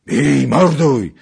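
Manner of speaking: drawn-out, high, very loud yell; wild voices roaring the charge; wild battle cry. A narrator's reading. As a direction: savage attack shout, stretched out. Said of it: "powtórzyły dzikie głosy przy drugim".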